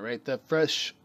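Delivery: ordinary delivery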